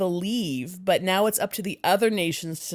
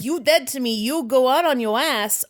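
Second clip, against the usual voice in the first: sassy snap; 5 semitones higher, 6 dB louder than usual. Retold or imitated. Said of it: "I just did a little bit of a snap".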